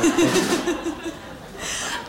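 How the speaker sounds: high unvoiced giggle; high breathy laugh. Real, not acted. Host keeps laughing.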